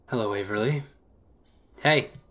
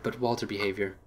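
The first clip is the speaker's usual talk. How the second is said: usual speaking voice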